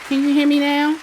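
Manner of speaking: calling loudly down a bad line. Real, not acted. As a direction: make sure they hear you, loud. Host shouting over bad line.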